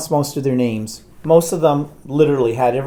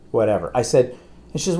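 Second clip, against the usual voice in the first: ordinary talk, nothing marked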